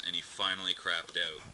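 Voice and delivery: usual speaking voice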